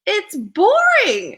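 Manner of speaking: high, loud, drawn-out grumble; exasperated complaint. Retold or imitated. Model confessing her job is dull, exasperated. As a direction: stretch it out in exasperation.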